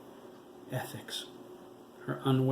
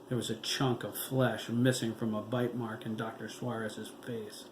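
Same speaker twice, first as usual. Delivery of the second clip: ordinary delivery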